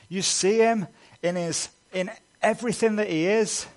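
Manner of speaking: high, loud preaching at full force; impassioned pulpit voice, swelling on key words; fervent preaching. Acted. Passionate preaching about Christ's glory.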